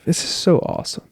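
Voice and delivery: breathy hushed awe, under his breath; awed whisper. Real, not acted. Alone at night, marveling at his own life, whispering. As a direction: whisper it like you can't believe it.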